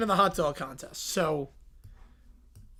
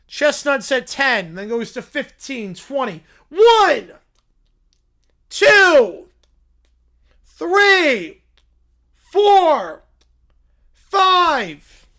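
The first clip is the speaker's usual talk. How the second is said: counting hot dogs louder, building; play-by-play; 4 semitones higher, 9 dB louder, much slower than usual. Acted. Announcer counting the bear's hot dogs, building louder. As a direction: call it like a live eating contest.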